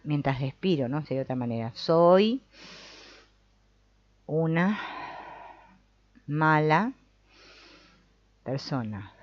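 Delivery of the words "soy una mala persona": long pauses between each word. Shown as a demonstration. Therapist showing word by word delivery. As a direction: give every word its own beat. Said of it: "palabra por palabra".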